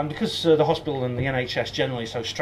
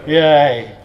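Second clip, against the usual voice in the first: a loud little cheer for the team; loud playful hooray; mock cheer; 11 dB louder than usual. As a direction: cheer it like a rugby fan. Cheering in Welsh rugby shirt.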